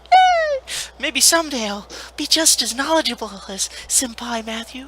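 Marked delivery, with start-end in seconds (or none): 0.0-0.6 high excited cheer; excited yay. Real, not acted. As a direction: squeal yay with glee. Cheer for Spider-Man.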